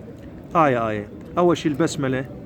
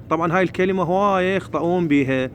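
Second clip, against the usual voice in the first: ordinary talk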